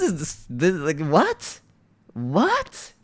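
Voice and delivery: rising, high bewildered question; baffled. Real, not acted. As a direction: ask in total disbelief. Baffled reaction.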